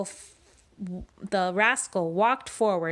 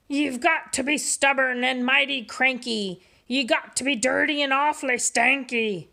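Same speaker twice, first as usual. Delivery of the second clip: gruff pirate captain speechifying, a bit higher; 5 semitones higher than usual. Acted. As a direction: preach the pirate code like a swaggering captain. Pete's pirate voice.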